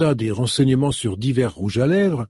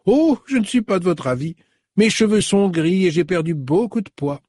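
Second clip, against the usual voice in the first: high, effusive welcome; delighted older woman; 7 semitones higher than usual. A narrator's reading. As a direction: greet an old friend with a burst of joy. Mrs Buckle voice.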